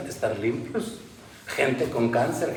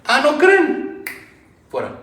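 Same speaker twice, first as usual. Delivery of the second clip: high, slowed scornful retort; Jesus rebuking scoffers; 12 semitones higher, 5 dB louder, slower than usual. Acted. Jesus dismissing the mourners who laughed, scornful. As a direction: toss the question back at them.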